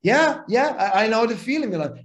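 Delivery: a knowing dig at him; mock sympathy. Mentor mocking his feelings.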